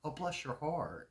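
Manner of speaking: honeyed put-down, drawn out; sarcastic blessing. Retold or imitated. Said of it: "our way of being sarcastic".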